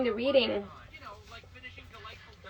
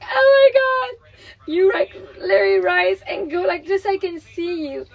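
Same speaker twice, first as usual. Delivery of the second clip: crying out, higher and louder, voice breaking; tearful outburst; 6 semitones higher, 9 dB louder than usual. Retold or imitated. Mother retelling how the book made her cry, tearful loud outcry. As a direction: relive being moved to tears. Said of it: "I was crying".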